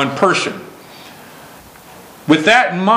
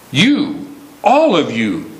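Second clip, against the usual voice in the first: "You, all of you" with a hard push on "all"; stress on all of you; 2 semitones lower, slower than usual. Shown as a demonstration. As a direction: lean on "all" to sweep in the whole room. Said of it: "he is speaking of".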